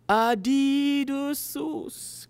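put-on high, drawn-out "Adidas" in a learner's accent; foreign learner's accent. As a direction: repeat it slowly with a foreign accent. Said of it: "Cal said".

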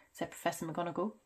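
ordinary delivery

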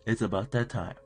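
usual speaking voice

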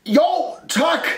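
high, loud, curt reply; slightly irritated shout. A narrator's reading. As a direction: shout back with a touch of annoyance. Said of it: "råbte Rumle lidt irriteret". (råber lidt irriteret)

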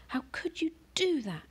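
high hushed accusing mother; mother's reproach. A narrator's reading. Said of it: "she asked".

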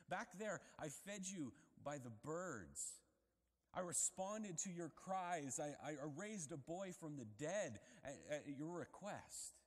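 rising, slowed insistence; God reminding Elijah, building loud. Acted.

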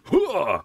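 high-pitched strike grunt; short, high attack yell; battle cry. Pretend. Player lunges at Missus Charlotte, battle cry.

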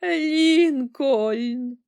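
loud, high wailing cry; hammed-up tearful outcry; sobbing old woman. A narrator's reading. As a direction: wail the name in grief. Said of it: "ридала вона".

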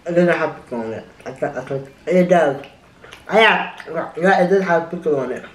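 talking while chewing; speaking through a bite of food; mouth full. Real, not acted. Seen 1: Food visible in open mouth while talking, sandwich held in hands.

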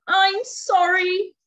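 high, loud, slow apology; mildly spluttering storybook dragon; spluttered apology. A narrator's reading. Frightened dragon apologising to the beast, spluttering. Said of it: "he spluttered".

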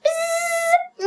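high buzz; buzzing insect. Shown as a demonstration. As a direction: buzz like a fly. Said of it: "Okay, so here".

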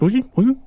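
high squeaky "ooh"; short high comic squeaks; comical poking noise. Acted. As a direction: make a little cartoon poke sound. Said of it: "sort of like that comical noise".

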